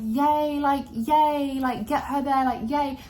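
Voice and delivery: high, bright mock cheer; giddy little yay; excited cheering. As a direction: cheer her on, thrilled. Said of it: "I was like".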